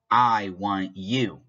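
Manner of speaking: I want you said slowly and deliberately, each word spaced; slow it right down on I want you to show two subjects; slow emphatic demo. Shown as a demonstration. Teacher slowly stressing I want you to show the two subjects. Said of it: "It has to be two different subjects".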